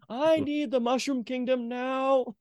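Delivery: high, slow, drawn-out presidential impression; Lincoln. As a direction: stretch it out high like a statesman, unrehearsed. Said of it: "That's not bad for unrehearsed Lincoln".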